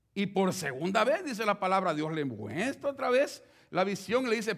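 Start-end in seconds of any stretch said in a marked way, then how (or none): none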